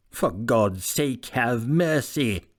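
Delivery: very slow, strange begging voice; a trapped man begging for mercy; pleading voice. A narrator's reading. Hyde begs from behind door.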